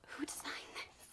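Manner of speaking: a baffled whisper to herself; whispered aside. Real, not acted. Booktuber baffled by the cover design, whispered aside.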